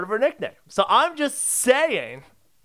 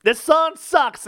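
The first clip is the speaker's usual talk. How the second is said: mock outrage; 7 semitones higher, 6 dB louder, slower than usual. Repeats complaint louder to Nick.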